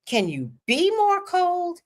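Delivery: high, slow indignant jab; indignant retort. Retold or imitated. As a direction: throw the question back, high and drawn out.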